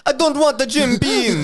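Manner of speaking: high, loud mock Jamaican accent; hammed-up Jamaican accent. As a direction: rich kid refusing the family whiskey in mock Jamaican accent. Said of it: "that Jamaican accent".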